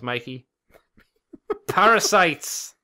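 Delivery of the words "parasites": slow booming proclamation; loud slow dramatic reveal; dramatic announcer voice. Retold or imitated. Quoting the show dramatically.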